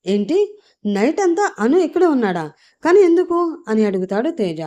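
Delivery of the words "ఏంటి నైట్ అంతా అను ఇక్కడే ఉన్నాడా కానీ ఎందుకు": rising higher, much louder on the last words; stunned, ending close to a shout; shocked disbelief. Acted. Teja hears shocking news.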